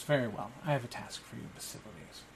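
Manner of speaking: smiling lord quietly dooming his servant; sinister lord. Acted. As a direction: let the menace sink to a hiss. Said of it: "the young man smiles at him and goes".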